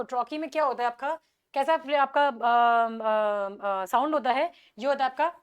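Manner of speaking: usual speaking voice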